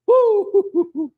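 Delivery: high, loud delighted whoop; excited whoop. Real, not acted. Cook tasting his own crawfish, whooping with delight. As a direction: let out a joyful woo at the taste.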